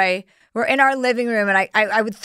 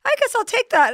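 much higher, airy shrug of a voice; mock-innocent high voice; 11 semitones higher than usual. Herself finding joints in the backyard, mock-innocent high voice. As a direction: play it like a sly little treat. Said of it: "And I was like".

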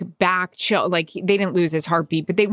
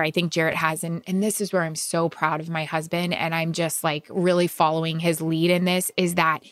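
normal talking voice

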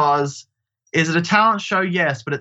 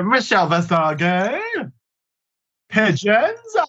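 mock character voice; 6 semitones higher, slower than usual. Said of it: "And they'll go".

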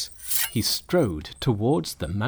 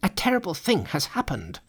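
higher-pitched monsters bringing bad news; alarmed announcement; 7 semitones higher than usual. A narrator's reading. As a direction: raise the alarm in a high voice. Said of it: "they announced".

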